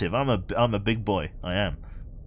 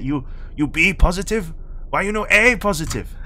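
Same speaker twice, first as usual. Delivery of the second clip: mock Chinese-accented dad, higher and louder; 7 semitones higher, 5 dB louder than usual. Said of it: "It's like".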